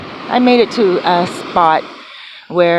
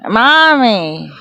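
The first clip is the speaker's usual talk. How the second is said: higher, louder mock calling out; calling Mommy; 4 semitones higher, 4 dB louder, much slower than usual. Imitating her friend waking her at night, mock calling out. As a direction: call her awake like a child. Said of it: "all of a sudden I hear".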